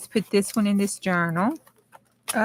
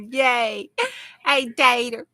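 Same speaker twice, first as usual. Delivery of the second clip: ordinary talk, nothing marked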